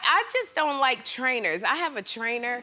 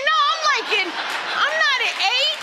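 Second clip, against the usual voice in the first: high indignant protest; 9 semitones higher than usual. Retold or imitated. Telling the trainer she is past eight, high indignant protest. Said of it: "I said".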